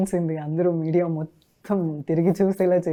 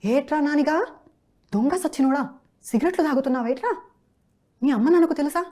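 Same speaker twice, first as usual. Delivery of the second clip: high-pitched scolding in Rajahmundry slang; rude scolding; 10 semitones higher, 4 dB louder than usual. Acted. Dubbing artist demos a rude film character catching a lad smoking, high-pitched scolding. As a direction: tell him off rudely, pitched high, questions rising.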